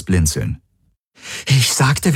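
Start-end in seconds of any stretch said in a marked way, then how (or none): 1.5-2.1 impatient. Acted. Repeating demand, losing patience.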